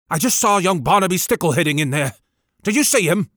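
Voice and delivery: quick, worried vicar voice; anxious vicar. Acted. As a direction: rush it out anxiously. Vicar hurries up.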